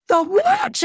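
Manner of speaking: high, startled question; shocked echo. Retold or imitated. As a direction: imitate a wife taken aback. Wife's shocked reply, retold.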